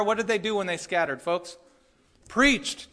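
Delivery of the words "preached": punchy answer. Acted. Answering his own question forcefully.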